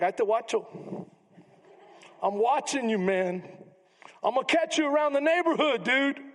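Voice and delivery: a hint of a neighborhood tough sizing someone up; street tough threat. Preacher voicing Abner's brother as a street tough, mock threatening. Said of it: "But he's like".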